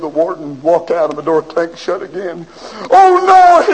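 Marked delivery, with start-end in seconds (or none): 2.9-3.7 anguished cry. Acted. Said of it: "he said".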